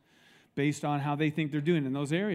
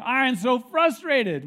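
slow, higher, louder whine of frustration; frustrated groan; 7 semitones higher, 7 dB louder, slower than usual. Retold or imitated. Fed-up pastor complaining to the group, slow loud groan. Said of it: "And he said".

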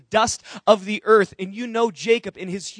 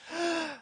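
gasp; 8 semitones higher, 11 dB quieter than usual. Acted. Sudden realization in sermon.

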